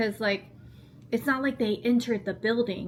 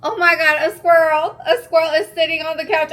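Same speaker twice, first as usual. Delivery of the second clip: sudden gasp, much higher and much louder; startled gasp; 7 semitones higher, 11 dB louder than usual. Real, not acted. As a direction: blurt it out, shocked at the squirrel. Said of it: "I just got distracted 'cause I seen a squirrel".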